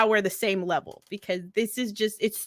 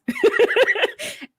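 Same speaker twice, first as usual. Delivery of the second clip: high laugh; 4 dB louder than usual. Real, not acted. Laughs at own joke.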